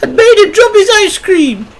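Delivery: very high, loud female voice; indignant mum reporting in a piercing falsetto; complaining mother. A narrator's reading. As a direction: explain it loudly, high and aggrieved. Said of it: "she explained".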